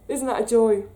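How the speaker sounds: normal talking voice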